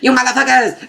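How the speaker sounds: high mock enraged yell; hammed-up shout of fury; mock furious cry. Tissaia raging at the attackers, mock yell. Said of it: "Tissaia is like".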